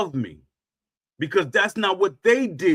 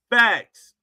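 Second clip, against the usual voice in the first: loud, clipped declaration; emphatic punchline; 5 dB louder than usual. Retold or imitated. Hammering each boast with a loud mock "Facts". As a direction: slam the word down. Punctuating each boxing claim.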